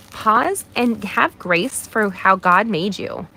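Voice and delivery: ordinary delivery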